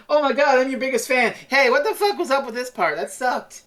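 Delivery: gushing fan. Retold or imitated. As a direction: gush then complain like a fan. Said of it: "it's just such a good, like, fan thing".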